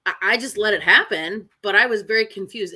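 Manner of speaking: usual speaking voice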